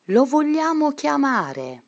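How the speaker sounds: rally the class with bright courage; eager resolve. A narrator's reading. Angela urging her classmates to call Jesus, high and determined.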